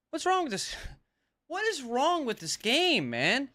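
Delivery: high-pitched, exasperated demand. Real, not acted. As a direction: plead in disbelief at a broken game. Gamer fed up with the game.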